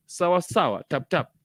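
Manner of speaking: normal talking voice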